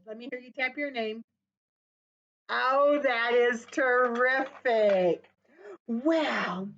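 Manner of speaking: usual speaking voice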